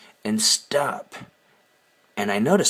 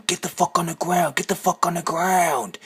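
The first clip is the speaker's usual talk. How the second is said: mock yelled police order, higher pitched; retold scream; 7 semitones higher than usual. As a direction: imitate a cop screaming, without full volume. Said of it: "screams at them".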